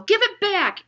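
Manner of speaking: a boy's high loud attempt at a knightly shout; knightly yell. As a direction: try to sound like a knight but squeak. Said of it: "in a knightly sort of voice".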